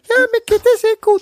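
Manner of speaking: squeaky puppet voice. Pretend. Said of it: "so ein Dialog zwischen Tom Hanks und Bill Murray".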